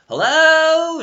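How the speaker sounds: very high, loud squeaky cartoon hello; shrill-free high toon greeting. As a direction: pop into a high cartoon character voice. Animaniacs voice impression attempt.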